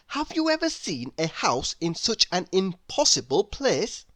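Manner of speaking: usual speaking voice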